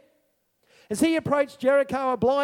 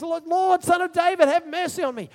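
loud, higher desperate plea; crying out; 6 semitones higher, 5 dB louder than usual. Acted. Blind beggar calling to Jesus, loud and higher. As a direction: plead out over the crowd.

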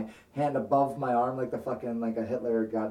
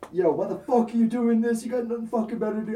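higher, louder, fast angry rant; going off; 9 semitones higher, 4 dB louder than usual. Retold or imitated. Telling off the blackmailer, a furious rant. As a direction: tear into the blackmailer. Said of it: "you started going off on him".

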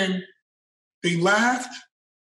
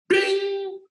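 high chirped bing; ping it like a message alert; imitated ding; 12 semitones higher than usual. Announcing the office message from the boss, high imitated ding.

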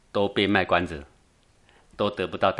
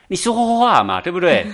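exasperated demand; 9 semitones higher, 5 dB louder than usual. Retold or imitated. Monk's frustrated demand retold.

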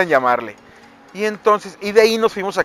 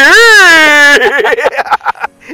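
big loud burst of laughter; 10 semitones higher, 11 dB louder than usual. Real, not acted. Laughing at own punchline.

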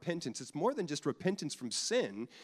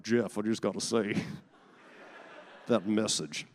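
mock churchgoer; 4 semitones lower than usual. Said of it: "if afterwards you're like".

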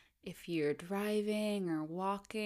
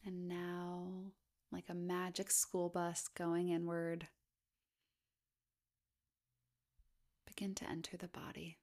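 hushed, even body-scan voice with long pauses; guided meditation; 7 dB quieter than usual. Acted. Guide inviting listeners to scan down the body, guided meditation. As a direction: gently lead a meditation, unhurried, leaving space between phrases.

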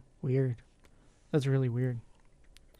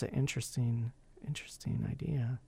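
whispered aside; 4 semitones lower, 12 dB quieter than usual. Real, not acted. Trailing thought, whispered aside.

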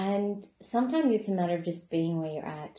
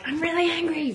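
much higher, louder angry snap; mock confrontation, pitched way up and loud; angry in someone's face; 10 semitones higher, 5 dB louder than usual. Shown as a demonstration. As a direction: throw "I'm really angry" right at them. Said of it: "right in someone's face".

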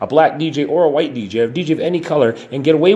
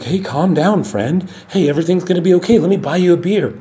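higher, soothing buddy voice; mock friendly bar crowd; 5 semitones higher than usual. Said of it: "Everyone's like".

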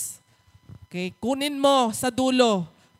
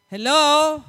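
loud, higher teasing call to the crowd; 5 semitones higher, 9 dB louder than usual. Real, not acted. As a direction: wake up the congregation. Calling out to unresponsive congregation. (malakas na tawag sa kongregasyon)

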